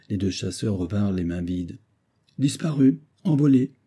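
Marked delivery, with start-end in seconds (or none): 2.4-3.7 high, louder exclamation on each word; exclaiming. Acted. Captain returns empty-handed from the hunt, exclaiming. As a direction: exclaim over the vanished quarry. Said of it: "s'écria le capitaine Hodde".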